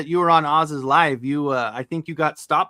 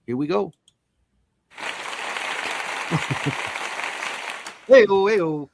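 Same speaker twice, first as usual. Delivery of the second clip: louder, stage-announcer build-up to the guest; announcer intro; 3 dB louder than usual. Acted. Host bringing the guest on stage, announcer style.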